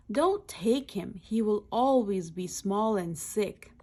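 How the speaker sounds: usual speaking voice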